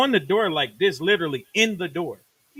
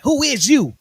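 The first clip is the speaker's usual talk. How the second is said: louder and higher mock threat; menacing rapper; 9 semitones higher, 9 dB louder than usual. Rapper with a gun to the engineer: a mock menacing demand. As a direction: demand it at gunpoint.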